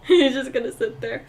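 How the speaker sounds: usual speaking voice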